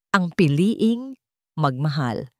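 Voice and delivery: ordinary delivery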